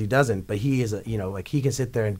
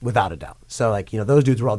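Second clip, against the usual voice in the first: ordinary talk, nothing marked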